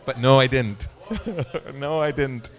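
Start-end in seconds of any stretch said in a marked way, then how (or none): 0.9-1.7 brief amused chuckle; short laugh. Real, not acted. Preacher owning up to his slip, short laugh. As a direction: let out a quick soft laugh. Admits the slip was unplanned.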